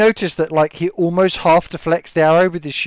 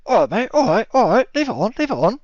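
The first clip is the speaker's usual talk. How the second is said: a higher, soothing bloke voice; placating lizard folk; 7 semitones higher than usual. Acted. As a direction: back down, calm the other guy. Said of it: "he kind of turns and goes".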